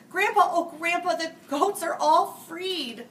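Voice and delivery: put-on a kid shouting across the yard; calling child. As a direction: holler the news to Grandpa. Grandkids calling out to Grandpa.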